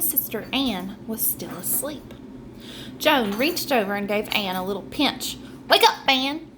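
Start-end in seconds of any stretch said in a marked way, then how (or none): 5.7-6.5 scary and urgent. Acted. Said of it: "making her voice scary and urgent".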